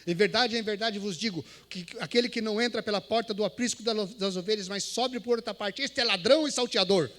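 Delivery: loud, solemn Bible reading; scripture reading. Shown as a demonstration. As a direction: proclaim the verse from the pulpit. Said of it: "E comecei".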